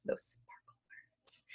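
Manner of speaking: slow, secretive whisper; hushed whisper. Shown as a demonstration. Teacher names describing words for pupils, slow whisper. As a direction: whisper it slowly.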